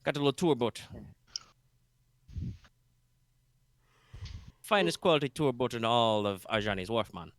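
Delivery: a light Jamaican-bayou lilt, easygoing; vaguely Jamaican bayou accent. Acted. Boat captain greets the party, lightly Jamaican bayou accent. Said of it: "my vaguely Jamaican bayou accent".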